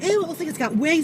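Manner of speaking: high grossed-out voice; disgusted ew. Retold or imitated. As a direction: recoil in disgust. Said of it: "the daughter's like".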